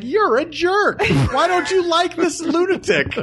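high, laughing mock voice; high mocking family voice. Voicing the family.

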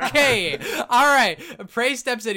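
loud, high-pitched interruption; calling to order. Real, not acted. Game master reining in joking players, loudly calling to order.

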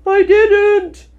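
high, loud, urgent denial; hurried interruption. A narrator's reading. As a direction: cut in hotly, pitched right up. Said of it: "interrupted in a great hurry".

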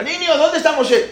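high-pitched questioning call. Retold or imitated. Pharaoh asking children where Moshe is, calling out. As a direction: shout across to the children. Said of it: "Paró para el otro lado y le pregunta".

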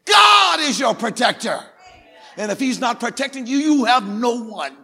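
high, shouted preaching with stretched key words; full-force preaching. Real, not acted. Preacher telling scared people that God protects, full-force preaching. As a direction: thunder it to the whole church.

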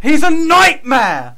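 very high, very loud, drawn-out wail; hammed-up shout. Co-host ranting about an obsessed fan, hammed-up shout. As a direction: bellow it slowly in mock despair.

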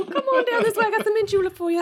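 put-on high Southern accent. Joking about the alien's voice, high Southern accent. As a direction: invite them like a Southern host. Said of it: "I expect a high Southern accent".

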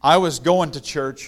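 ordinary delivery

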